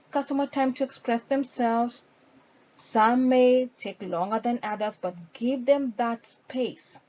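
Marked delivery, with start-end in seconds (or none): none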